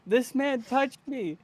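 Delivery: put-on very high, loud childlike accusation; mock alarm. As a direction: cry for help in hammed-up panic. Child character publicly accuses sheriff.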